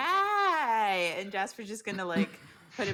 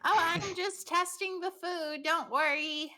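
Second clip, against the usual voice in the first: much higher, slow breezy lady voice; haughty lady; 10 semitones higher than usual. Acted. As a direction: brush it off airily. Jasper answers shopkeeper.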